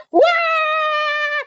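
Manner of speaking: high, wild wail; shout. Retold or imitated. The mind screaming under pressure, high panicked shout. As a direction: let out a panicked cry. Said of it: "sumisigaw na siya ng ganun".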